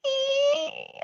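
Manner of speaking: very high thin squeak; door squeak imitation. Telling his co-host about the door, high squeak. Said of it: "and I went".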